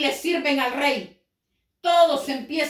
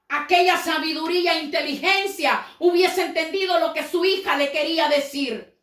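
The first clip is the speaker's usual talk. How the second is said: ordinary talk, nothing marked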